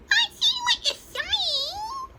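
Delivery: very high, slow chick voice with a laugh; peeping chick. A narrator's reading. Smallest chicken agrees, high laughing peep. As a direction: chirp it like a tiny chick. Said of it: "peeped the smallest chicken".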